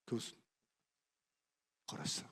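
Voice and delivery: ordinary talk, nothing marked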